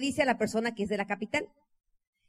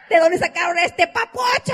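loud excited gushing; 6 semitones higher, 10 dB louder than usual. Retold or imitated. Cousins gushing over handsome guest.

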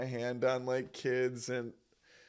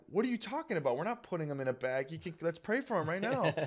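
put-on high-pitched indignant kid protest; exasperated mock plea, pitch jumping up; retold frustration; 5 semitones higher, faster than usual. Frustrated kid refusing to let mom bag the lizard, indignant. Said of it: "I remember being so frustrated".

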